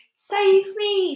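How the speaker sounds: high, loud cry for rescue; giant's scream. A narrator's reading. Giant trapped in his nightmare, screaming. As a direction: scream for help, pitched right up. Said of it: "screamed the flesh lump eater".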